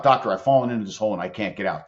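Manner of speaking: ordinary delivery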